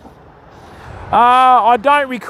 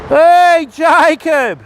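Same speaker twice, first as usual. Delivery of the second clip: loud, raised, drawn-out hail; calling out a greeting; 6 semitones higher, 5 dB louder, much slower than usual. Real, not acted. Streamer greets viewer joining chat, calling out loudly. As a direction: hail a mate who just turned up.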